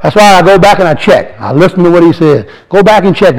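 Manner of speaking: ordinary talk, nothing marked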